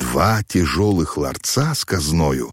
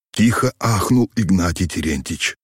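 usual speaking voice

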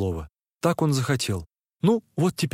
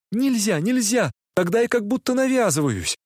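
flustered refusal; 8 semitones higher than usual. A narrator's reading. Nastenka, flustered, refuses to seem forward in her letter. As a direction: protest in a fluster.

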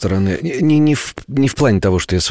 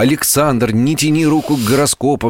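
stern self-scolding; 7 semitones higher than usual. Retold or imitated. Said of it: "чтобы я себе запрещаю".

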